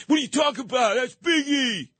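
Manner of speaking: hammed-up indignant shout; mock yell. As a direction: bark it back like an offended fan. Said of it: "they start yelling at me".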